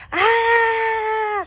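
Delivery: high, loud sustained "ah" scream; demonstrated scream. Acted. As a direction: let out one long, piercing yell. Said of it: "screaming, like".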